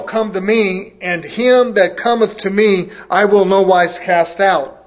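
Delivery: booming pulpit scripture reading. Acted. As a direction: proclaim the verses like a preacher. Reading scripture aloud in KJV.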